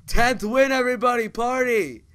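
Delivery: loud, high victory yell; celebratory shout. Real, not acted. Player announcing a tenth win to everybody, celebratory shout. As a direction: shout it out to the whole party.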